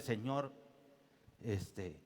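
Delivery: low, soft, halting plea; hushed prayer. Retold or imitated. Desperate father handing his son to God, hushed prayer. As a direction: sink into a quiet, pausing surrender.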